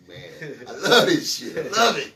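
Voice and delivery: laughing, thrilled by the point; real delighted laughter. Real, not acted. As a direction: laugh through it, loving it. Delighted by the chess analogy.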